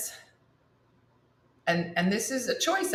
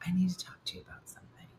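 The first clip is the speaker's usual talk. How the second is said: ominous whispered warning; 12 dB quieter, much faster than usual. Retold or imitated. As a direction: whisper it like bad news is coming. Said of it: "The tone changes".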